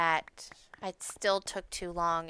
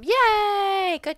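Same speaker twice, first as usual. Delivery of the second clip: high, loud happy yay; delighted cheer; 13 semitones higher, 7 dB louder than usual. Real, not acted. Gamer cheering a friend's win. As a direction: cheer it with joy.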